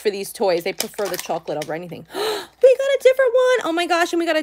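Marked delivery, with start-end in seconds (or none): 2.1-2.6 sharp delighted gasp; a quick gasp of surprise; surprised gasp. Real, not acted. Surprised by a different toy. 2.6-4.4 high, fast thrilled squeal; excited squeal. Real, not acted. Thrilled at getting a new toy.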